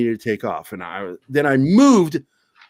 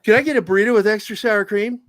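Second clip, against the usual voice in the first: mock cheery customer; 6 semitones higher than usual. Cheery order to ex-boss.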